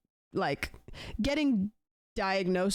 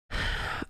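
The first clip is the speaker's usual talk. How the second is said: defeated sigh. Real, not acted. Giving up on pharmacy clerk.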